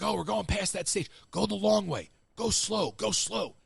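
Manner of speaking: mildly excited mock pleading; eager urging. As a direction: imitate coaxing the driver along. Retold sneaky urging in golf cart.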